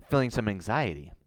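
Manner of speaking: ordinary delivery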